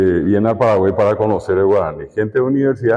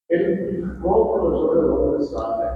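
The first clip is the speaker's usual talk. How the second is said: a timid student's question, high and soft, slow at first; student asking; 6 semitones higher, 5 dB quieter than usual. Retold or imitated. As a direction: play a shy pupil asking the teacher. Student question voiced by teacher. (estudiante tímido que pregunta en voz baja y aguda)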